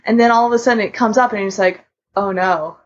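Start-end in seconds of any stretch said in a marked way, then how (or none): none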